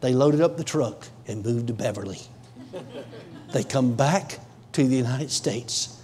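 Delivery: low, soft narration with long pauses; hushed testimony storytelling. Real, not acted. Preacher retelling couple's conversation in the car, hushed and low. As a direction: draw listeners in quietly, voice dropped.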